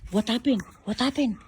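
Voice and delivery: usual speaking voice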